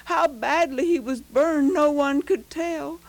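usual speaking voice

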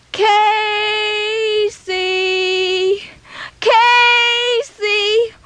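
far-off calling of a name; mother calling. Acted. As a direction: search for your lost boy in the dark. Mother calls through the storm.

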